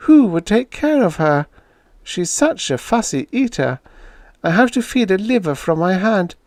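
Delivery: distraught owner fearing for her cat; grieving Julia. Acted. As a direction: dwell on the loneliness, aching.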